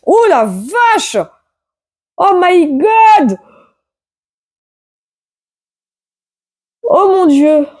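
high, drawn-out cries of real shock; shocked outcry. Real, not acted. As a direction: cry out, stunned by the cards. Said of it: "Pardon de gueuler comme ça". (exclamations de choc, aiguës et traînantes)

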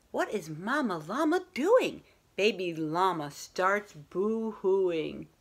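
plain reading voice

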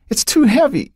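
lightly voiced girl, mildly straining; strained girl. A narrator's reading. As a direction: push it out as if failing under the weight. Becky straining.

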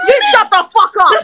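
loud, heated retort; angry shout. Real, not acted. Caller snaps back at streamer in a quarrel, angry shout. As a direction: throw the order straight back, loudly. Shouting match, answering shut up.

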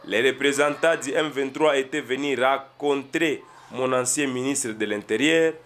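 low, measured reading voice; news quote read-out. Acted. Lit une déclaration citée du président. (lecture posée d'une déclaration, voix plus basse)

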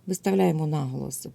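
normal talking voice

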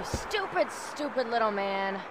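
scolding Fred quietly; muttered scolding. Acted. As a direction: grumble it under your breath. Owner scolds Fred under breath.

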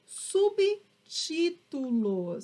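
subtítulos slowly, syllable by syllable; teacher spelling out subtítulos by sound; broken into syllables. Shown as a demonstration. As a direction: draw out subtítulos in separate chunks, sub - tí - tu - los. Teacher introduces term syllable by syllable.

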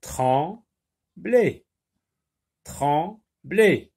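"Trembler" split as trem... bler; two measured beats on "Trembler", gap between; syllable by syllable. Shown as a demonstration. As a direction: read "Trembler" as if clapping each syllable. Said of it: "lis les mots en frappant les syllabes".

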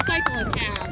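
loud and fast over crowd noise; shouting to the crowd; hoarse marathon cheering. Real, not acted. Cheering runners at the roadside.

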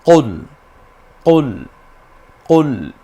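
repeated throaty কুল; recite along. Shown as a demonstration. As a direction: model কুল for them to repeat. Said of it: "আমার সাথে একটু মিলিয়ে পড়ুন".

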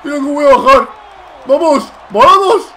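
high, loud hype building to a flying leap; excited shout. Real, not acted. Gamer launching a dive off the ropes, shouting. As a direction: scream it as you jump off the top rope.

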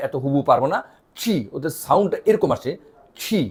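normal talking voice

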